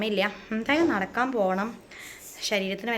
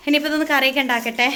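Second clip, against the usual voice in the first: usual speaking voice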